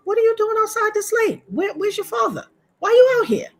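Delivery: high, fast, worried mother's reproach; alarmed scolding. Retold or imitated. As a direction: demand answers from a child out too late. Finds child outside late.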